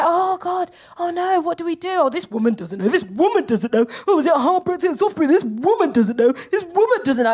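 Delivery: lightly voiced hand-wringing crowd, swinging high; mock public panic. Comic voicing everyone doubting the leader, mock panic. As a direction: fret in mock dismay.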